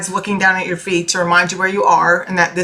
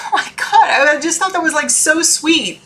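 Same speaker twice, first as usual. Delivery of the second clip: touched and emotional; 7 semitones higher than usual. Real, not acted. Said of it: "And I'm gonna get emotional".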